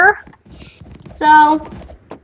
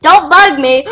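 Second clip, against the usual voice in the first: ordinary delivery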